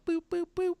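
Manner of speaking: very high electronic boops; imitated marquee beeper going off; beeper sound. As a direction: chirp it like a tiny beeper. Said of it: "another little, little beeper".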